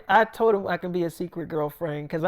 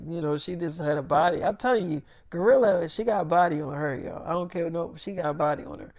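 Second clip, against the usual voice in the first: normal talking voice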